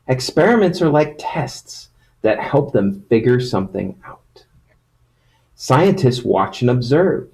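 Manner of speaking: storybook reading voice; reading to children. Acted. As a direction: read it like a picture book to kids. Reads picture book to children.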